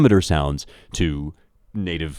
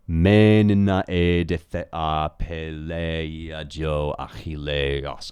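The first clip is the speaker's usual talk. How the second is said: "Me nina e de the a peleia jo achilleos" chanted slowly, long syllables drawn out; slow, exaggerated dactylic chant of "Me nina e de the a peleia jo achilleos"; exaggerated Greek metre; slower than usual. Shown as a demonstration. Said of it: "I'm exaggerating a little bit".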